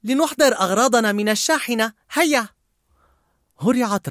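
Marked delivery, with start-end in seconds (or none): 0.0-2.5 high, eager character voice; rally everyone to get going; peppy, much higher suggestion; Joy. A narrator's reading. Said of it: "اقترحت فرح".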